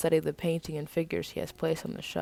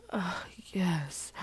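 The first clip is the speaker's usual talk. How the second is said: moaning; 5 dB quieter than usual. Acted. Moaning heard from tent.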